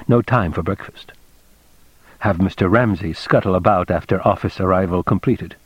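ordinary delivery

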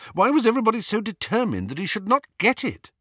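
high, fast burst of exasperation; frustrated. A narrator's reading. Harry wanting the truth kept from him, frustrated. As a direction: rush it out in indignation.

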